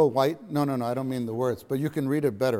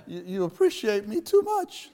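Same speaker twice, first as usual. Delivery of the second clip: 1 imitating Brother Lee; 8 semitones higher, 3 dB louder than usual. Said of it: "how he would put his lips go".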